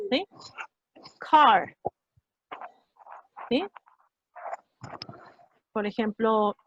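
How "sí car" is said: ordinary talk, nothing marked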